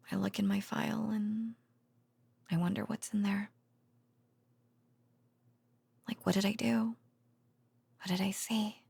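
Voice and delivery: hushed, haunted wondering; wistful whisper. Acted. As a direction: whisper the questions as if afraid of the answers. Wondering about own erased memories.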